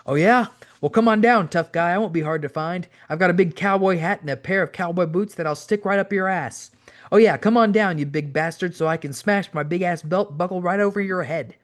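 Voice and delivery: excited tough-talking taunt; cocky challenge. A narrator's reading. Owen's eager challenge to a fight.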